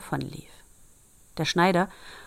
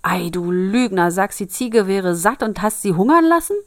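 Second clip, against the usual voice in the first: loud, rising accusation; indignant tailor telling off his son; angry scolding; 3 semitones higher, 7 dB louder than usual. Acted.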